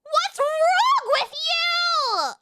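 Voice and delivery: hammed-up high, drawn-out yell of disgust; disgusted shriek. Streamer scolding in mock horror, disgusted shriek. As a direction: squeal it out very high, stretching the words.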